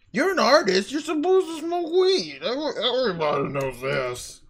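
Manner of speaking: high, put-on know-it-all voice; mock the people who assume artists smoke. People assuming artists smoke weed, put-on mocking voice. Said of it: "people always assumed".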